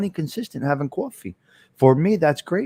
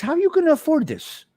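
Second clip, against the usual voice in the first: much higher, mock-shocked pitch; incredulous question; 13 semitones higher than usual. Car dealer asking how the kid can afford this, mock-shocked and high. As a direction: squeak it out like an old dealer in disbelief. Joe's alarmed question, retold.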